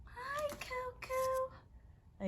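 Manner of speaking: very slow, high, soft drawn-out hello; tender pet greeting. Real, not acted. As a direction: melt it out gently to calm the dog.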